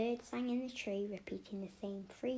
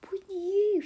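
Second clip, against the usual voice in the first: high, much louder accusing cry; indignant discovery; 11 semitones higher, 14 dB louder than usual. Acted. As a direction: shout the discovery at her friend. Realizing the hum was Opal.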